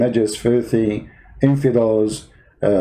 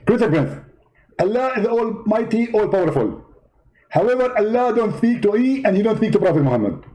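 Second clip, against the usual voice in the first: high, indignant preacher voice; Zakir Naik impression; 6 semitones higher than usual. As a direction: play the offended debater, pitched well up. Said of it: "Zakir Naik?".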